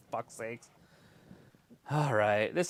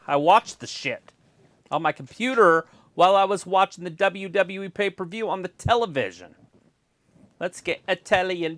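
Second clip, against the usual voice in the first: usual speaking voice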